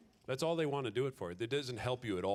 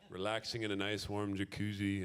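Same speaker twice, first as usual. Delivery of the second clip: ordinary delivery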